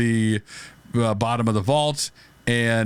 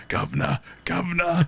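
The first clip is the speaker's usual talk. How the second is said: mock Londoner; 5 semitones higher than usual.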